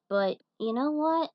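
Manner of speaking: ordinary talk, nothing marked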